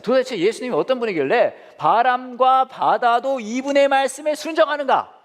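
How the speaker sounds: high, slow, drawn-out proclamation; awed amazement. Acted. Disciples marvelling at who calmed wind and sea, astonished.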